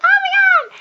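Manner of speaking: high, loud mock squeal; imitated screaming fans; fangirl scream. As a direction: shriek it like a thrilled crowd. Imitating the crowd's reaction.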